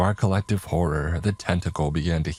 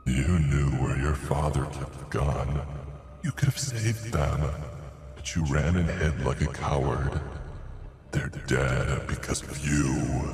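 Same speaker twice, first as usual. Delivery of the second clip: low, eerie taunting creature voice; sinister monster voice; 3 semitones lower, 4 dB quieter than usual. Acted. Heads accuse the narrator.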